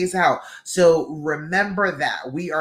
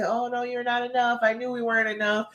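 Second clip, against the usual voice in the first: higher-pitched mock fretting; negative inner voice; 6 semitones higher, faster than usual. Coach imitating the self-critical brain, mock fretting. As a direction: put on the doubting brain's high monotone whine. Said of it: "your brain can't just always say".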